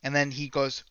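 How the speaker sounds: ordinary talk, nothing marked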